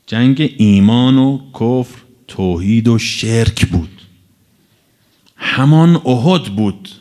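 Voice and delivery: weighty sermon emphasis; slow emphatic declaration. Real, not acted. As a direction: spell out the verdict deliberately. Slow emphatic doctrinal point.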